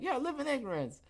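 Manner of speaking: high, breezy "live in ignorance"; airy mock acceptance; mock resigned quote. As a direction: toss it off lightly. Mock quote of own reaction.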